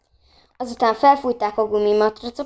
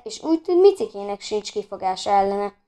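ordinary delivery

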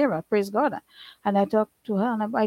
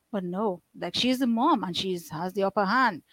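normal talking voice

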